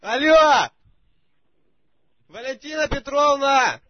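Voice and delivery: high, loud shout into the phone. Real, not acted. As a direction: yell to be heard on a bad line.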